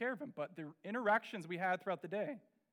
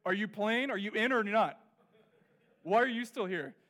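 put-on impatient kid; 2 semitones higher, 7 dB louder than usual. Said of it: "You know what middle school kids wanna know?".

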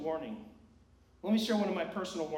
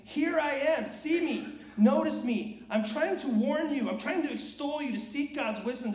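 higher, louder pleading call; voicing Wisdom calling out; 7 semitones higher, 6 dB louder than usual. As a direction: cry out like Wisdom waving from the street. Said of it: "Wisdom is standing there saying".

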